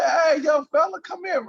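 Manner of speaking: big friendly shout across the room; calling out. Retold or imitated. Wilt calling him over.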